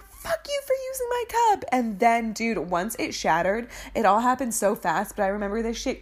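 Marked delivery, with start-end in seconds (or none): 0.0-1.7 put-on much higher wailing kid voice; mock crying. Child wailing at little sibling after the cup shatters, high mock sob. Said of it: "I immediately start crying".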